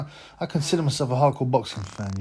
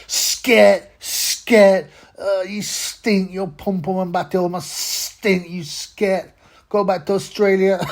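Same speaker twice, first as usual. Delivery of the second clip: shouted high and loud, slowing on you stink; mock shout; 7 semitones higher, 7 dB louder, much slower than usual. Vlogger yelling at a passing boxer across the street, loud mock shout. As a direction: shoo her off down the street, yelling.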